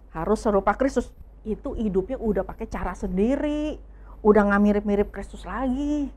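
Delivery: normal talking voice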